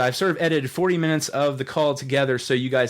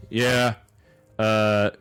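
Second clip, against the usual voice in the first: low, unenthused welcome; hating it; 6 semitones lower than usual. Retold or imitated. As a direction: imitate a host who hates being there. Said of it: "He hated it".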